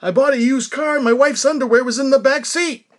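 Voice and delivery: high, loud put-on comic whine of a standup; Rodney Dangerfield impression. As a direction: mimic an old man doing a famous comedian. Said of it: "doing this Rodney impression".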